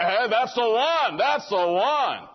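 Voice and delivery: mock fired-up excitement. Young man spots a girl and gets fired up, mock excitement. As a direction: stretch it out, hammed-up and thrilled.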